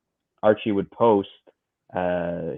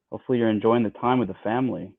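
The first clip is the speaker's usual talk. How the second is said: usual speaking voice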